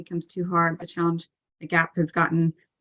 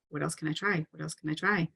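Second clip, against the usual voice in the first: calmer level; 8 dB quieter than usual. Acted. Coach modelling everyday problem solving, calm and soft. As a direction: ask it quietly, without the urgency. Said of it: "like, a calmer level".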